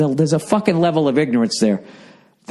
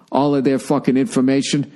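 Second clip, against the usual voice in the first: usual speaking voice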